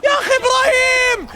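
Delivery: excited shout. Real, not acted. Praising player, shouting.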